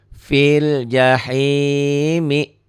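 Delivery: slow tajwid chant; Quran recitation. Shown as a demonstration. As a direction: draw the words out like a reciter. Recitation example. (lantunan tartil pelan)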